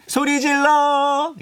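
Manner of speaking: playful concert-MC shout, very high and drawn out; rally the crowd with a long high holler; hype-up shout. Pretend. DJ hypes listeners like crowd. (콘서트 사회자처럼 높고 길게 외치기)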